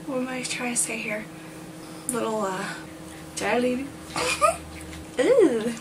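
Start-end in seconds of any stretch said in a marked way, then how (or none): 3.9-5.2 breathless high-pitched giggle fit; real, helpless giggles; giggling at her own blooper. Real, not acted.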